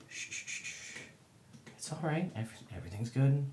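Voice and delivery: soft hushing, then gentle reassurance; calm nervous animals with a hush; soothing. Acted. Player settling snarling wolves, softly reassuring.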